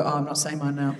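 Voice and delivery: a mocking low aside from a put-off audience member; deflated audience member. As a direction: imitate someone backing out sheepishly, half laughing. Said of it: "do you know what it made everyone else do? Go".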